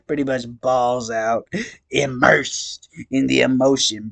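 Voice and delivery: slow, drawn-out clown monologue; stoned clown voice. Acted. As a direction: ramble on like a weepy clown. Gamzee monologue voice.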